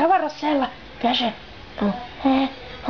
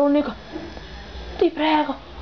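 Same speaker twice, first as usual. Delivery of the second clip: ordinary talk, nothing marked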